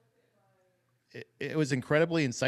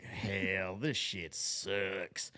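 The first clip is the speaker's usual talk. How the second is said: much lower, softer, slow mock gripe; low slow grumble; 5 semitones lower, 8 dB quieter, much slower than usual. Host imitating the congressman's weary complaint, slow and low. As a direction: drag it out low and quiet. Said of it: "another time where he's like".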